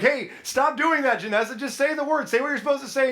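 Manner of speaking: high, urgent mock yell; imitated yelling. Teammates yelling at a struggling player, imitated yell. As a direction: imitate exasperated teammates yelling over each other. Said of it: "everybody's still yelling at her".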